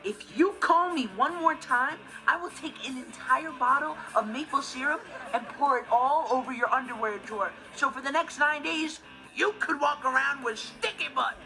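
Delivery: indignant, emphatic tirade; irritated threat. Acted. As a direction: threaten a pesky prank caller. Threatens prank caller over phone.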